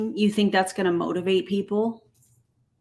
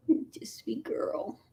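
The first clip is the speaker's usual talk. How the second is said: whispering; 2 semitones higher, 10 dB quieter than usual. Real, not acted. Whispering to the cat.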